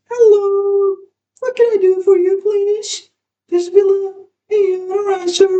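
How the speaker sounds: high put-on phone voice for the caller; exaggerated gentle phone voice. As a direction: coo into the receiver like a lady. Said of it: "picks up the receiver with an exaggerated gentleness".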